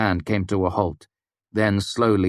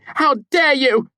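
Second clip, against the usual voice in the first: much higher, louder, slow outraged scream; slower furious shout; 7 dB louder, slower than usual. A narrator's reading. Devi repeating her scream at Kvothe, slow and furious. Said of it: "she shouted".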